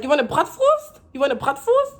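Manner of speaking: high, loud insistent question, BRATWURST said German-style with "vorst"; German pronunciation. Retold or imitated. Repeating the offer to the customer, German pronunciation. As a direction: keep BRATWURST stubbornly German. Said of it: "the German pronunciation".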